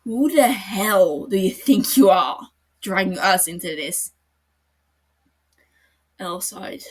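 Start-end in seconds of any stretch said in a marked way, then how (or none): 0.0-4.1 loud, furious accusation. Acted. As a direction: hurl it at him in fury. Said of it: "turning to L with a fury".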